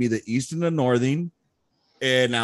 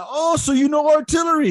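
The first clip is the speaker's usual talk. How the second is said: put-on high, louder Vietnamese-accented challenge; 6 dB louder than usual. Sergeant major challenging Lopez's artillery knowledge, Vietnamese accent.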